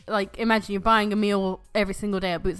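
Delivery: loud insisting. Real, not acted. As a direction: push the point loudly. Insisting loudly in argument.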